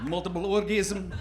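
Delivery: soft, expressionless Russian accent; cold, miserable mock Russian; deadpan comic Russian accent, no expression. Shown as a demonstration. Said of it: "Now, do a Russian accent".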